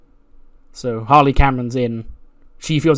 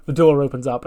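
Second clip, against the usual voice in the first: ordinary delivery, nothing marked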